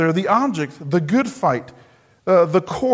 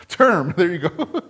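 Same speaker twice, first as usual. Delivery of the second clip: laughing at himself. Real, not acted. Laughs at his stumbling.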